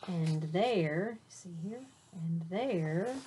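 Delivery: lively storybook read-aloud; reading to children. A narrator's reading. Teacher reading a picture book to her class, animated. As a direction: read it bright and animated for little listeners.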